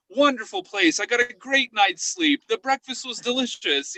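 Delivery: high, sing-song cheery review; mock reviewer. Investigator mocking glowing Yelp reviews, chirpy. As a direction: read it like a gushing tourist. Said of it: "five-star reviews".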